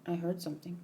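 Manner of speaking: hushed unvoiced whisper. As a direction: breathe it so no one overhears. Character whispers a warning.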